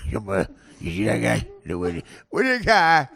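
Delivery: garbled Italian-American street banter. Acted. Blue-collar men interacting, slurred Italian-American accent.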